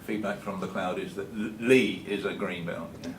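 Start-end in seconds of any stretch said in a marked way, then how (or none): none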